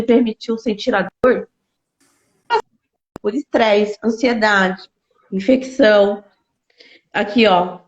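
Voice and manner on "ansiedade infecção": ordinary talk, nothing marked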